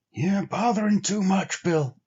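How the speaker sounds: sleepy Henry. A narrator's reading. Henry half asleep telling Bill he worries too much, drowsy. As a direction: mumble it as you drift off. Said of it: "came the sleepy response".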